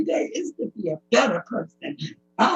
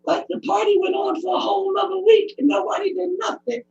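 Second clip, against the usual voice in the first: higher, tearful near-shout; real upset spilling over; crying out; 7 semitones higher than usual. Real, not acted. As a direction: raw tearful outburst. Distressed testimony, crying out.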